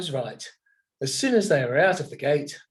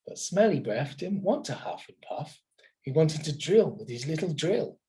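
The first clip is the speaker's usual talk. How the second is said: plain reading voice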